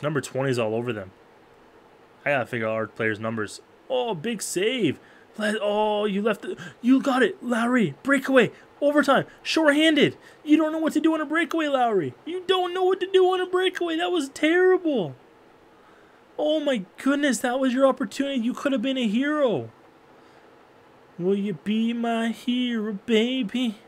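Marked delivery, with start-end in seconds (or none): 3.9-15.1 high, loud play-by-play that rises to a scolding; frantic excitement. Real, not acted. Streamer yelling at his player on the breakaway, high excited shout. Shorthanded breakaway chance in overtime. 16.4-23.8 high, teasing sing-song; mock swoon. Real, not acted. Streamer teasing the player who missed, high mock swoon.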